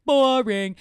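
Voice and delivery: exaggerated loud complaint of boredom; bored mock verdict. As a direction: call it out like a heckler. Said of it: "I'm kinda like".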